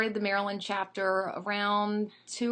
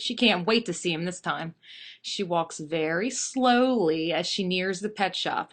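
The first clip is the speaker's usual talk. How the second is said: ordinary delivery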